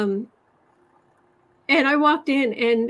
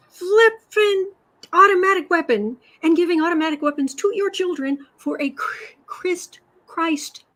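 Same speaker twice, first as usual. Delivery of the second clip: real indignant outburst; angry outburst; 6 semitones higher than usual. Real, not acted. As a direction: let the anger spike on "flippin'". Angry rant at hypocrisy.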